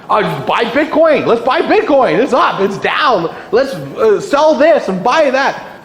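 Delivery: hammed-up aimless trading; mock impulsive investor. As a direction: play an investor jumping with no plan.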